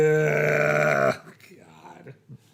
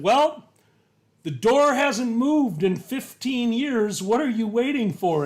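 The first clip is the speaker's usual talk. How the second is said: retorting husband; 5 semitones higher, 4 dB louder than usual. Retold or imitated. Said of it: "And he said".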